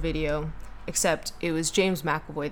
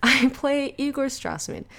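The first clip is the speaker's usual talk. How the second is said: laughing, higher put-on English voice; a giggly stab at Daniel Radcliffe; half-laughing mock Radcliffe; 8 semitones higher than usual. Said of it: "in a Daniel Radcliffe voice".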